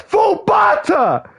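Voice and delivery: high, slow frustrated shout; exasperated yell. Real, not acted. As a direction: yell out the wrong name in disbelief.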